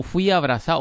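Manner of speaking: cita de meme, bastante más fuerte; lánzalo como frase de meme, a todo volumen; cita de meme en voz alta. Retold or imitated. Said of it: "¿Cómo es que dice aquel meme?". (frase de meme dicha en voz alta)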